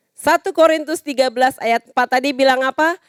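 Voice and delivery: ordinary talk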